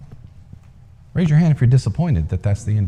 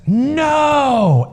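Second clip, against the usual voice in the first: crowd shout; 3 dB louder than usual. A narrator's reading.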